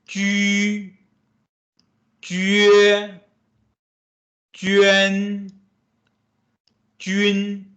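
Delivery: ju, jue, juan, jun read as clear model syllables; teacher modeling Mandarin syllables; Mandarin syllables demo. Shown as a demonstration. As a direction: model each syllable slowly for the class.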